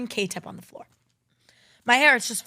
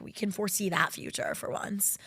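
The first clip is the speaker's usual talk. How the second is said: whispered aside; conspiratorial whisper; 6 dB quieter than usual. Real, not acted. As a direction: say it under your breath. Knowing aside.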